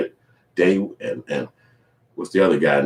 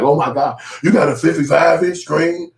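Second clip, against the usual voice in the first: mock surprise; 8 semitones higher, 4 dB louder than usual. Shocked at the TV.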